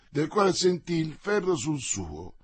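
ordinary delivery, nothing marked

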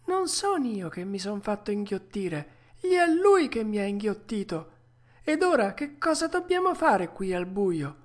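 put-on high young puppet voice; indignant puppet boy. Puppet corrects the tuna about what he is, high indignant voice. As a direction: pitch it up, without extra force.

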